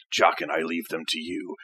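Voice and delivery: low, quiet lordly delegation; deadpan master, softly dismissive; Sesshomaru impression. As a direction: drop low and soft, unbothered. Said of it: "He's just like".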